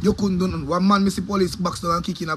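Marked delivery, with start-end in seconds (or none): none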